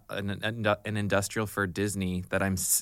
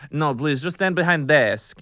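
higher, louder voice in broken English; audition director; 6 semitones higher, 7 dB louder than usual. Retold or imitated. Director placing the actress behind the desk, accented and insistent. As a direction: imitate a foreign director giving orders.

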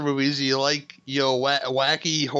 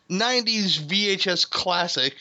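"classic" given deliberate emphasis, phrase pitched higher; stress on "classic"; 5 semitones higher than usual. Real, not acted. Host teasing next episode's film, stressing "classic". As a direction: lean on the word "classic". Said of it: "I stress the word classic".

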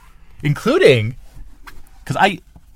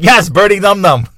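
excited agreement; 9 semitones higher, 9 dB louder than usual. Retold or imitated. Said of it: "I'm like".

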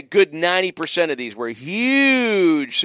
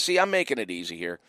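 ordinary talk

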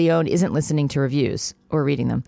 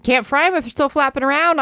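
put-on high, matter-of-fact old lady; Ida Bell's voice; 10 semitones higher, 3 dB louder than usual. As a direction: say it up high and blunt.

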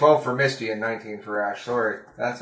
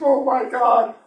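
mock desperate plea; 6 semitones higher, 4 dB louder than usual. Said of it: "made some plea of".

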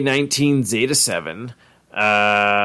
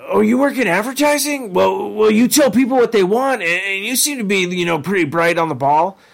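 much higher, eager and gushing; Doofus Rick voice; 7 semitones higher than usual. Retold or imitated. Doofus Rick praising Jerry's advertising job, high and eager. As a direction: flatter him sincerely like a goof. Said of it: "he's like".

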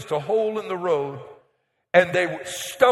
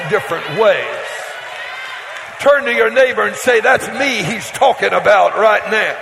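loud, rising sermon read of the blessings; preaching at full force; 5 dB louder than usual. Acted. Preacher proclaiming Deuteronomy's blessings to his church, loud and rousing. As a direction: proclaim every blessing to the back row.

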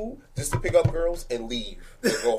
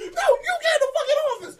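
angry teacher. Retold or imitated. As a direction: yell him to the office, half laughing.

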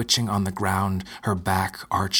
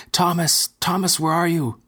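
high frantic yelling; desperate shout; 9 semitones higher, 3 dB louder than usual. Acted. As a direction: scream for him. Lucy screaming for Thomas.

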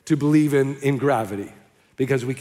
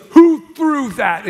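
higher, much louder, drawn-out indignant demand; angry heckled speaker; 9 semitones higher, 8 dB louder, much slower than usual. Retold or imitated. Soapbox speaker demands the tomato thrower from the crowd, angry. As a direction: bellow it, outraged at being hit. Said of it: "he got all angry".

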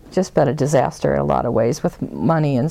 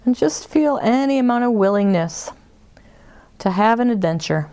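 guided meditation. Acted. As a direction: ease them inward, unhurried.

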